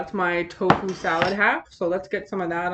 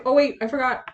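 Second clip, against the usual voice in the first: oh wait, higher and louder; sudden realization; 6 semitones higher, 4 dB louder than usual. Real, not acted. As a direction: catch yourself mid-goodbye. Suddenly remembers something.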